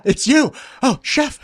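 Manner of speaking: high, amazed mock gasp of recognition; starstruck recognition. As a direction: act out a fan spotting a famous chef. Acting out people recognizing the famous chef.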